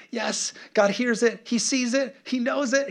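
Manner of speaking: high, climbing gleeful cheer; Moses, excited. Acted. Moses celebrating that God hears his people, acted out excited. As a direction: build into a high, elated squeal. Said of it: "so Moses is so excited".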